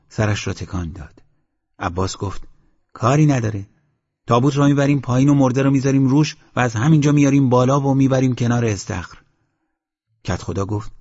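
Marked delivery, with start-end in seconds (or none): 2.9-9.2 quick, higher and louder, brushing off the problem; fast, keen proposal from a young villager; eager young man. Acted. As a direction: rattle off the plan, sure it's easy.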